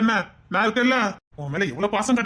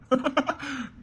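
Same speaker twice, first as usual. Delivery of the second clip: quiet, high sneaky chuckle; sly laugh; 6 semitones higher, 6 dB quieter than usual. Acted. Liar laughs privately at his deceit, sly chuckle. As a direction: laugh to yourself at the trick. Reveals he kept photos.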